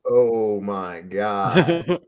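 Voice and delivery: slow, stunned oh my God; slow awed oh my God. Retold or imitated. The kid answers the code phrase, slow stunned awe. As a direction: drag it out in dawning shock.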